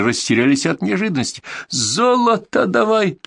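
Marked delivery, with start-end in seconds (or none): 1.7-3.2 slow, high-pitched furious demand; spluttering demand. A narrator's reading. Bandit with a knife to a hostage demands gold, spluttering high and slow. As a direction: draw it out high, spitting with rage. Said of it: "брызгал слюной Кацо".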